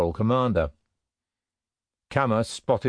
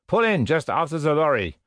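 raised-pitch command to the driver; sergeant's order; 6 semitones higher than usual. A narrator's reading. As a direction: give a brisk military order. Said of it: "the sergeant in command of the patrol ordered".

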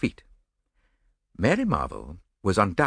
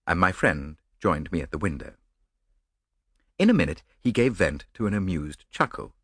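ordinary delivery, nothing marked

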